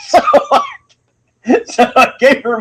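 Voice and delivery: squealing laugh into the words. Real, not acted. Comedian confesses his bad choice, laughing hard. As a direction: laugh high and talk through it.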